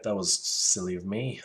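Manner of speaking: ordinary delivery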